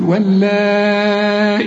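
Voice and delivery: واللائي stretched slowly as if stopping; recited at a pause. Shown as a demonstration. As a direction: chant واللائي and hold it. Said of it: "وإذا وقفت".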